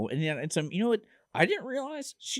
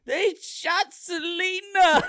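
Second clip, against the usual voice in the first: high, loud, drawn-out mock wail; hammed-up scream, slow and high-pitched; 5 dB louder, much slower than usual. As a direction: scream the news in exaggerated horror. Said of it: "comes into the gym and screams".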